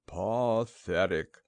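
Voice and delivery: put-on low, slow old donkey; slow, low-pitched glum donkey voice; gloomy Eeyore. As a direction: drag it out dolefully. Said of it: "Eeyore, the old gray donkey".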